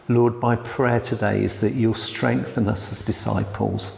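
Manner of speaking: ordinary delivery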